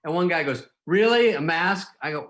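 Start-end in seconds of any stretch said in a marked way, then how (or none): 0.8-1.9 mock indignant, higher and louder; incredulous guy. Fan balks at the mask, incredulous and loud. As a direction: huff it like an outraged fan.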